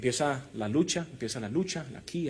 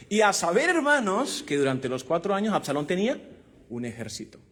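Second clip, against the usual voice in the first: ordinary talk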